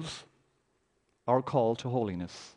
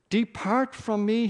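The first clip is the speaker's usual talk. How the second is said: loud, high, slow cry to be left; desperate plea; 10 semitones higher, 6 dB louder, slower than usual. Acted. Peter begging Jesus to leave, loud and slow. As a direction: push him away with the voice.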